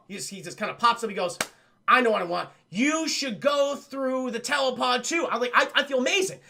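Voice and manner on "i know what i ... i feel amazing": retold manic pitch, bursting with energy. Energized scientist urging her into the pod, manic excitement. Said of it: "this crazy amount of energy".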